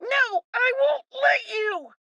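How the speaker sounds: high shouted refusal; defiant shout. Acted. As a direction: yell it as they grab you. Boy shouts at captain.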